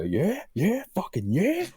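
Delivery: high mock gym-bro cheer; imitating a bloke. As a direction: ham up the macho guy's yeah. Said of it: "you see the guy go".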